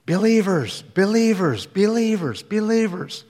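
repeated high cries, each one falling; hateful screaming, imitated. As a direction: imitate a hate-filled shriek, word after word. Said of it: "he started screaming in hatred".

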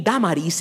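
"Damaris" said the Spanish way, DAH-mah-rees; Spanish "Damaris" with open a vowels; Spanish pronunciation. Shown as a demonstration. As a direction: say "Damaris" in Spanish, first syllable accented. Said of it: "in Spanish has an accent on the first syllable".

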